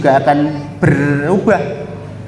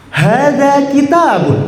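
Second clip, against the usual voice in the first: higher, slow model line; eager demo; 7 semitones higher, slower than usual. Shown as a demonstration. Teacher's take before asking for a bolder voice. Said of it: "Yang lebih lantang lagi".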